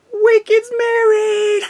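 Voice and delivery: high, loud, stretched-out cry of joy; excited announcement. Real, not acted. Vlogger bursting out her wedding news to viewers, thrilled shout. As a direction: shout the big news with delight.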